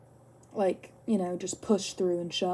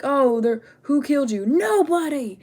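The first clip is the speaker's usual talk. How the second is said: higher, loud acted-out exchange; mock shouted exchange; 5 semitones higher, 7 dB louder than usual. Acting out the fake-name joke on the Cyclops, mock shouting. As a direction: call it out, rising to a hammed-up cry.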